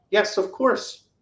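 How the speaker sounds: mock-eager, high, slow reply; eager acceptance. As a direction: jump way up in pitch, acting delighted to agree. Acted eager reply to audio prompt.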